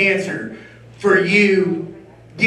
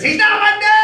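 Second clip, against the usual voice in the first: put-on bratty kid; 12 semitones higher than usual. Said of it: "this little bastard says".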